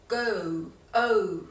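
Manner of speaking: "go، او" drawn out, ending on oo; slow "go" down to expose the closing oo; audible glide ending. Shown as a demonstration. Teacher showing the end of the vowel, stretched glide. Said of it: "تهش رو، م، اگه درست بشنوین".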